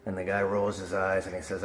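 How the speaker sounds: normal talking voice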